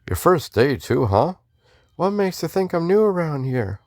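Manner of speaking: high-voiced Texan greeting in a Western accent. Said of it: "I was doing a Western accent".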